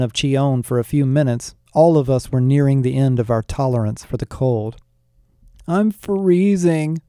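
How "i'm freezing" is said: high, slow whine; whining. A narrator's reading. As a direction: whine like a cold kid. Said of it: "Flynn whined".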